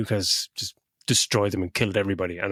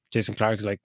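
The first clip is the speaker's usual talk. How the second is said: ordinary talk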